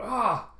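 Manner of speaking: startled yelp. Retold or imitated. Quan during evasive maneuvers.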